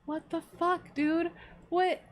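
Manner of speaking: indignant disbelief. Retold or imitated. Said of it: "I'm like".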